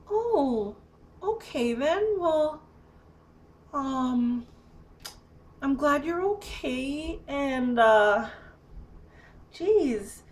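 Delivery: high, halting mimicry of a baffled helper; retold awkward visitor. Awkward visitor winding up to leave.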